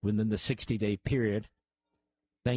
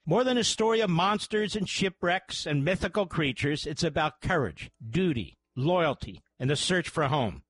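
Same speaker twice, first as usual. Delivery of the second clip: higher-pitched, emphatic radio ad read; sponsor ad read; 7 semitones higher than usual. Acted. Host pitching a free college course to radio listeners, ad read. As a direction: sell it with measured, rising emphasis.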